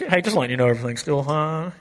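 relaxed pilot reassuring the cabin; calm pilot announcement. Retold or imitated. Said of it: "waiting for the pilot to".

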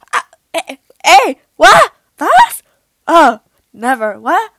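loud, high yelps of disbelief; hammed-up stunned outbursts; shocked stammering. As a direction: act out being lost for words, exclaiming loudly. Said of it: "ich war einfach nur schockiert".

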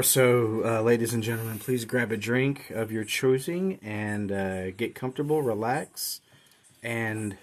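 soft low mock ASMR. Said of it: "we're gonna do some A S M R here".

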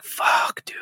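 hushed, unvoiced whisper. Recalling the shock of seeing his sick dad, whispered. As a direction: breathe it out in shock.

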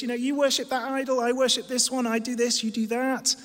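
high-pitched, chummy mock agreeableness; mock breezy tolerance. Preacher parodies a tolerant visitor to Athens, mock breezy tolerance. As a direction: gush as if everything is fine. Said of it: "Everyone's kind of very tolerant".

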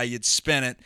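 ordinary talk, nothing marked

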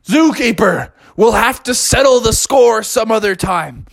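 high, theatrical urgency; earnest storybook bear. Acted. Bear telling zookeeper his friend is bitten, melodramatically urgent. Bear's dramatic appeal to zookeeper.

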